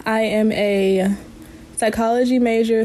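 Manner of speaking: ordinary talk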